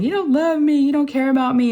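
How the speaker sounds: high-pitched mock wail; imitated crying. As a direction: wail like an accusing child in tears. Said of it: "she started crying, saying".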